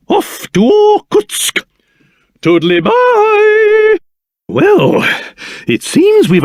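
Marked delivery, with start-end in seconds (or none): 0.1-4.0 high, long-drawn singsong goodbye; called-out farewell. Acted. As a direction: stretch it out high as you call goodbye. Calling out a cheery farewell.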